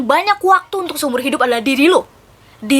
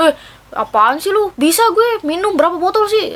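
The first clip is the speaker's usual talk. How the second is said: higher, cocky bragging friend, slowed at first; mock show-off friend; 6 semitones higher than usual. Friend brags about how many bottles, cocky tone. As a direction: play the boastful drinker who can't say no.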